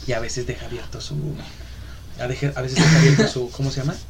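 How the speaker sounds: low, soft voice so the neighbour sleeps; hushed. Real, not acted. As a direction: drop to a quiet, low aside. Hushing friends, neighbour asleep.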